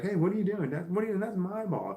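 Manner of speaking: playful shout over a stolen ball; mock yelling at dog. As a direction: yell at the dog to play chase. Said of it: "I start yelling, screaming at him".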